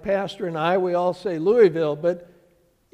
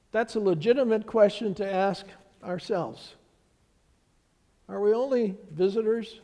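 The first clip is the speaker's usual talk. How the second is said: ordinary talk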